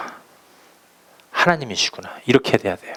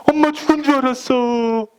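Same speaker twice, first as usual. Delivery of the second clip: put-on high, whimpering kid voice; a child telling mom through tears that he thought she had died; sobbing child; 12 semitones higher than usual. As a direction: blubber it out like a scared little child.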